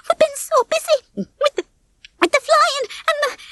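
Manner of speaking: very high, halting whimper; fretful panic. A narrator's reading. Babs excuses herself to Ginger, fretting. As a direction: fret and trail off helplessly. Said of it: "Babs wrung her wings".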